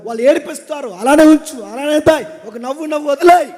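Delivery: loud pulpit exhortation with punched commands; full-force preaching. Acted. Preacher urging the men of the church, loud and fervent.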